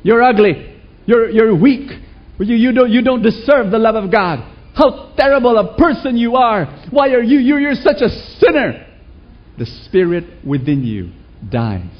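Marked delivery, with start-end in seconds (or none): 0.0-8.9 loud, high self-condemning yell; yelling insults at yourself. Retold or imitated. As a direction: berate yourself at full volume. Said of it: "if you yell to yourself".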